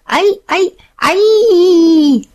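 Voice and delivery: high yelps building to a long loud cry; yelp, then hold the last one high; yell. A narrator's reading. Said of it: "he yelled".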